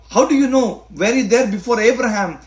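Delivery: a hint of doubting questioners; challenging question.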